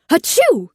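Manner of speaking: loud sneeze burst; big sneeze. Acted. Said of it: "went Floyd".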